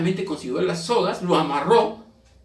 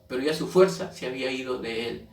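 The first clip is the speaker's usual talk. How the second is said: ordinary talk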